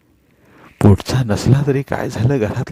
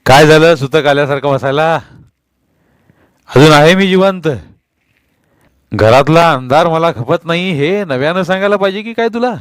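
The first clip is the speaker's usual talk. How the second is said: loud, higher furious scolding; angry husband; 5 semitones higher, 4 dB louder than usual. A narrator's reading. Husband scolding his wife about the dark house, angry shout. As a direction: berate her like a domineering husband. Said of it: "तिच्यावर खेकसला".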